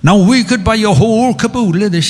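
high, bright Irish brogue; Irish accent. Retold or imitated. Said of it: "the Irish accent".

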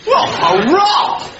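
drawn-out, high hurrah; jubilant cheer. Acted. Lieutenant hears of the revolution, jubilant cheer. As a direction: cheer it out slowly and gleefully.

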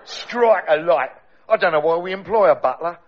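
lightly voiced Cockney pearly king, grumbling; a hint of a Cockney king moaning about his alarm clock. Pearly king grumbling to his wife Else, Cockney.

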